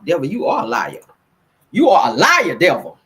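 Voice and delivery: loud churchy rebuke of the devil. Real, not acted. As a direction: call out the devil. Rebuking tech trouble, church phrase.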